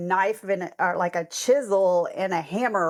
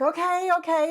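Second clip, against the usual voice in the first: much higher, hurried mock reassurance; flustered husband; 11 semitones higher than usual. Matt rushing to fetch the sweater, flustered. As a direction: placate her in a fluster. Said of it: "he's like".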